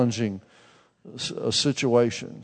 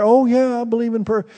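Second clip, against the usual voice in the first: put-on high, sweet old-lady voice, raised in pitch; mild old lady voice; old aunt voice; 9 semitones higher, 4 dB louder than usual. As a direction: play the frail old aunt greeting her preacher.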